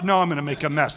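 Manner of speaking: usual speaking voice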